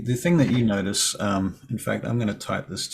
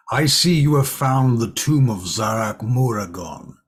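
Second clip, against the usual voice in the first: booming giant, louder and far; 2 semitones higher, 7 dB louder than usual. Acted. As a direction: boom it out like a giant. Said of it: "in her giant voice".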